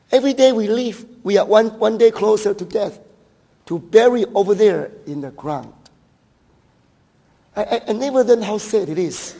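loud, high preaching on death; preaching at full force; fervent preaching. Acted. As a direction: drive home that every day brings death.

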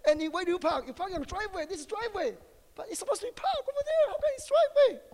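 mock confused driver. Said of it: "we said it a hundred times".

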